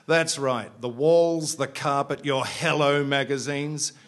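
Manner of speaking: gloating loud list; loud gleeful boast. Acted. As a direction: list them off proudly and loudly. Bunny boasts loudly.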